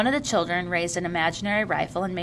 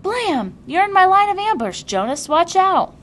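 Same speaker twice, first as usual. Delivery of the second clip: high, loud game yell; playful shout; 12 semitones higher, 9 dB louder than usual. A narrator's reading. Asher warns his friend in the game, shouting. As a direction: call out across the field.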